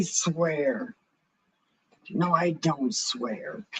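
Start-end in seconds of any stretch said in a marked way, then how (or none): none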